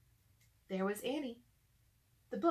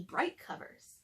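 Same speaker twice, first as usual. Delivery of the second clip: usual speaking voice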